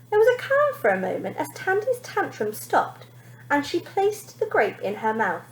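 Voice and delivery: usual speaking voice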